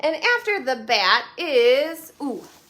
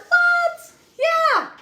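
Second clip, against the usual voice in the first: high shouted "what"; playful astonished cry; surprised; 8 dB louder than usual. Acted. Mock surprise at answer.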